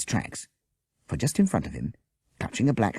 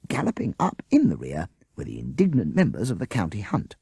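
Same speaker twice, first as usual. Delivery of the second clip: ordinary delivery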